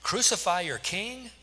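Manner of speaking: very high, astonished questioning; incredulous. A narrator's reading. Pilate answering the yelling crowd, incredulous. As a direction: echo it back in disbelief, pitch leaping up.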